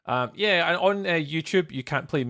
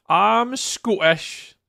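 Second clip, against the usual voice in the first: drawn-out, louder, higher mock Scottish brogue; hammed-up Scottish; 7 semitones higher, 6 dB louder, much slower than usual. Streamer answering a chat question, in a hammed-up slow Scottish accent. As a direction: stretch it out like a proud Scot, slow and loud. Viewer asks if he's Scottish.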